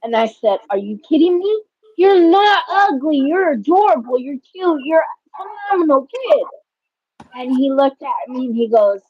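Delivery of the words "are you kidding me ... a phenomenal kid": high, emphatic retelling of praise; emphatic reassurance. Retold or imitated. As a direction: insist he's not ugly, much higher. Mother reassuring her son.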